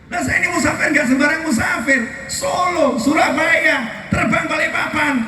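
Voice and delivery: high-pitched, animated preaching. Real, not acted. Preacher proving he is a traveller, animated preaching.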